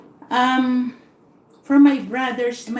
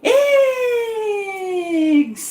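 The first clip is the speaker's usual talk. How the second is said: high, long-drawn market cries, falling away; imitated screaming vendors; 6 semitones higher than usual. Market ladies screaming their wares to shoppers, imitated cry. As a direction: stretch each word like a mock vendor's call. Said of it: "hear all these ladies already screaming".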